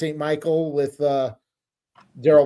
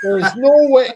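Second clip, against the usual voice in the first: put-on shouted, higher Minnesota accent; mock Minnesota rant; 9 semitones higher, 8 dB louder than usual. Wrestler blowing up at the movie Fargo, in a shouted Minnesota accent. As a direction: yell it in an imitated Minnesota accent. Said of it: "sounding just like one of the characters".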